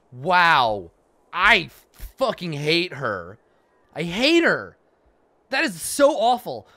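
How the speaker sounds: loud, high outraged venting; indignant rant. Real, not acted. Player venting about Juno, loud indignation. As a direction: vent the outrage.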